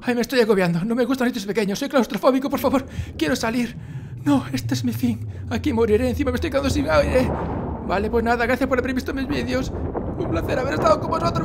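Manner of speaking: high, whiny hammed-up lament; mock despair. Trapped gamer bidding viewers a melodramatic farewell, high and whiny. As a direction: wail your fake last goodbye to viewers.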